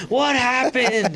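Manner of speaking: slow, high mom voice; a mother drawing it out suspiciously; mock concerned mom; mom asking. Mom voiced checking on child.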